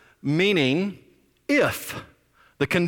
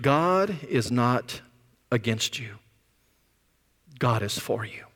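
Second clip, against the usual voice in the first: tender reassurance; 4 semitones lower, 4 dB quieter than usual. Real, not acted. Pastor reassuring congregation tenderly.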